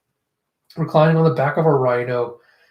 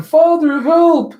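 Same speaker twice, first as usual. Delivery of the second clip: high, loud mock cry for help; loud, high-pitched plea; desperate cry; 13 semitones higher, 8 dB louder, slower than usual. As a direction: yell out as a child calling for rescue. Retold child's cry for help.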